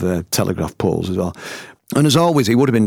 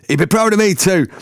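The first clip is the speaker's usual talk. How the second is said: high, raised bandmate's shout; retold shout; 10 semitones higher, 4 dB louder than usual. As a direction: pitch leaps up as if calling across a restaurant. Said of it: "another one of the band shouted".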